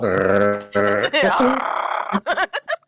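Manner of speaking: long imitated dog growl. Player voices Dogmeat warning the strangers, long growl. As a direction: hold a drawn-out growl like a dog. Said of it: "Dog meat is starting to growl".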